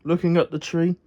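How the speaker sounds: plain reading voice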